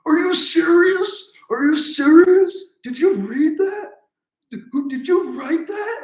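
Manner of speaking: very high, loud, slow incredulous outburst; Super Dave impression. Comedian appalled at Norm's joke cards, high incredulous yelps. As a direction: squeal in mock outrage, drawn out. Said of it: "Super Dave was like".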